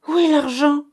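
high, loud sudden cry; mother suddenly shouting for the money; mother cries out. A narrator's reading. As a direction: demand it with a shriek of alarm. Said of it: "s'écria tout d'un coup maman".

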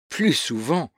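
mild cry of scornful refusal; exclaiming. A narrator's reading. Michel rejects his cousin's idea of marriage, exclaiming. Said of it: "s'écria Michel".

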